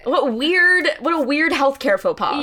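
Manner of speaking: high, loud disbelief; incredulous outburst. Retold or imitated. Patient reacting to the nurse's faux pas, loudly incredulous. Outraged reaction to weight said aloud.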